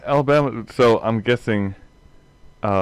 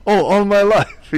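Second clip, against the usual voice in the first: much higher, louder mock German voice; German accent; 13 semitones higher, 8 dB louder than usual. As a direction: chirp it in a hammy German accent. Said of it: "German-accent joke retold".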